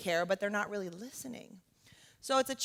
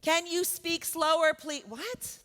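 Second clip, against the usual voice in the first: higher, slow, raised demand then a clipped please; mock impatient yell; 5 semitones higher, slower than usual. Rude customer snapping at a worker with an accent, slow mock yell. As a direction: talk down to them, every word dragged. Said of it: "Yelling at somebody with an accent".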